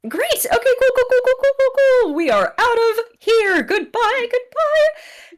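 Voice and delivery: high-pitched, flustered rush of words; hammed-up excited exit; frantic getaway babble. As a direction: babble high as you make your escape. Fleeing character, panicked sign-off.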